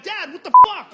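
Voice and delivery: loud, high betrayed yell; kid's outraged shout. Acted. Kid yelling at his dad about Santa. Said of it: "they're like".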